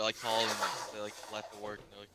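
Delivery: usual speaking voice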